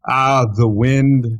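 choppy, stretched words over a bad line; buffering stutter. Real, not acted. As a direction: audio dropping out mid-sentence. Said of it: "you cut out there".

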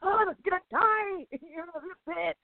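very high, hammed-up wail; mock panic. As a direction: wail in exaggerated dread for the mouse. Retold panic for the mouse.

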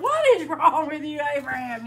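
loud, high-pitched incredulous outburst; mock exasperation. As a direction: scold him in comic disbelief. Preacher acts exasperation at Abraham.

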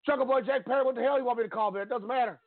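put-on high, annoyed old announcer voice; exasperated Jim Ross. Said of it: "He's like".

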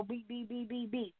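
soft, quick string of beeps; imitated detector beeps. Preacher showing how radar detector goes off, imitated beeps. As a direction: beep quietly like a radar detector.

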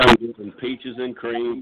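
loud, slow and relishing; big booming boast, slow and loud; hearty and slow. Real, not acted. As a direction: stretch out every word, savoring it. Exuberant reply to how you doing.

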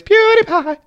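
the name jumped way up high in a squeaky mock call; mock youtuber intro. Podcast host mimicking the YouTuber's intro for listeners, high mock call.

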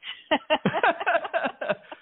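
a high burst of laughter. Real, not acted. Laughing at boxing metaphor.

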